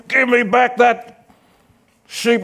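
slow, high challenge to a bear; boy David shouting at the bear, slow and high; mock yell. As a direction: call the bear out, drawn out.

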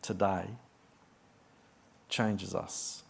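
soft, low prayer voice. Acted. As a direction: pray quietly and reverently. Opening prayer.

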